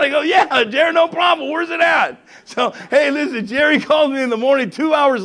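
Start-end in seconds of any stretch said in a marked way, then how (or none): 0.2-2.2 loud, high, excited reply; eager agreement. Retold or imitated. Pastor accepting Jerry's container job, eager and loud. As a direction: re-enact jumping at the offer, pitched way up.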